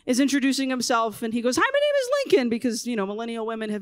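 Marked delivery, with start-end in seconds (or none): 1.6-2.5 high, sing-song preschooler introduction; little kid voice. Retold or imitated. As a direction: mimic a small boy proudly presenting his dad.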